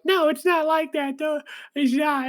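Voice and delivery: high, flustered voice, protesting; lightly voiced shy boy; flustered denial. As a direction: squeak out a nervous denial. Boy flustered denying he is dating.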